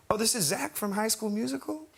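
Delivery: high, questioning put-on voice. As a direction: imitate a star's high voice, rising in recognition. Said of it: "It's, it's [name] again".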